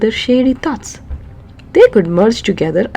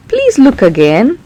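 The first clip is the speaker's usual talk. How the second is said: a touch of begging, louder; pleading; 4 semitones higher, 6 dB louder than usual. Acted. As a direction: plead gently with the king. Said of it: "Peso pleaded".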